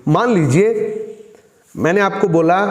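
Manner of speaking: ordinary talk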